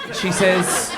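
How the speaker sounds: normal talking voice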